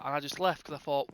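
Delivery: ordinary delivery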